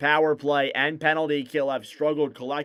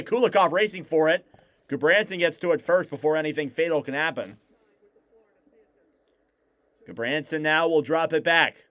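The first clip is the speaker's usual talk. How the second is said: play-by-play; 3 semitones higher than usual. Acted. Live hockey play-by-play.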